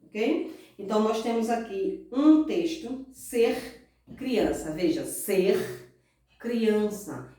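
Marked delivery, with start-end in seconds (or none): 3.1-4.7 "ser criança" very slow, the R of "ser" fully sounded; careful R. Shown as a demonstration. Teacher reading a title to pupils, careful R. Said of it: "pronúncia correta do R". 5.0-7.3 "ser criança" in two slow pieces, R clearly heard; careful R. Shown as a demonstration. Teacher asking pupils to notice, careful R. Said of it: "pronúncia correta do R".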